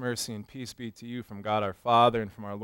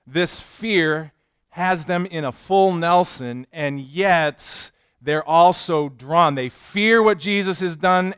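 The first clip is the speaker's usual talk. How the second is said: fervent sermon, louder and higher; preaching; 5 semitones higher, 11 dB louder, slower than usual. Acted. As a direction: build the sermon with conviction. Preaches with rising force.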